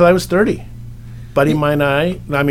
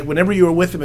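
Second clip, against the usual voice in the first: normal talking voice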